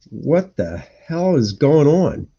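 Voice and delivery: ordinary delivery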